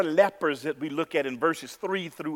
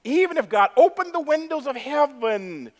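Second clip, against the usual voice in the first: higher, mocking scoff; sarcastic officer; 9 semitones higher, 4 dB louder than usual. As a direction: sneer at the prophecy. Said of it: "a royal officer that says sarcastically".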